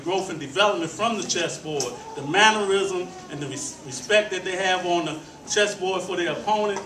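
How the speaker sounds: ordinary talk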